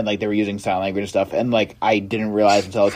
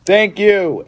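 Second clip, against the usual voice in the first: much higher, louder cheerful thank-you; loud chirpy thanks; 10 semitones higher, 5 dB louder than usual. Retold or imitated. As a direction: sing out a thanks on the way out. Thanking deaf staff loudly.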